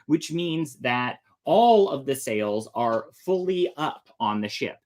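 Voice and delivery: plain reading voice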